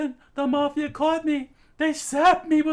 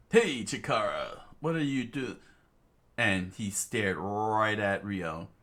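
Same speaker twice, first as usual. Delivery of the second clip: much lower, slow call; deep stranger calling; 6 dB quieter, slower than usual. Acted. A stranger calling Chikara from the car, deep slow voice. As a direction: call out low and heavy.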